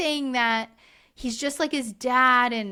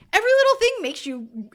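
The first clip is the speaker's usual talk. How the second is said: higher and louder, mock exasperation; imagined retort, pitched up and pushed loud; exasperated rant; 4 semitones higher, 4 dB louder, faster than usual. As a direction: lecture a man who says he's not emotional. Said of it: "And I'm like".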